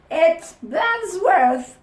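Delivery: loud, high, slow gleeful shout; crying out. Acted. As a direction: yell out the discovery. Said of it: "cried the guys".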